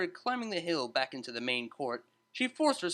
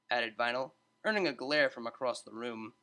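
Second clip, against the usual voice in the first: plain reading voice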